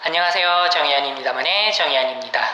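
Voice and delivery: tinny, fast telephone filter; as if heard through a phone speaker. Shown as a demonstration. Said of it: "전화기에 대고 말하는 소리로도 가능해요".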